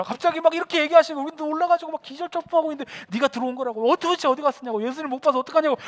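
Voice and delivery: acted agitated disciple; excited outburst. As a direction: blurt the whole story at your friend in a frenzy. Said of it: "막 흥분해가지고 막".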